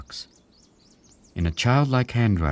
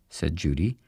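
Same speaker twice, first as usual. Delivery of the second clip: ordinary delivery, nothing marked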